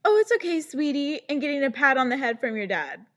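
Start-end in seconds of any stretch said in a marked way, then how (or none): 0.0-1.2 a higher, louder patronising coo; condescending dad. Retold or imitated. Mocking a dad patting his daughter, patronising coo. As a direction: pat her on the head with your voice. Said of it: "That's like being like".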